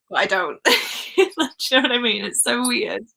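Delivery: ordinary talk, nothing marked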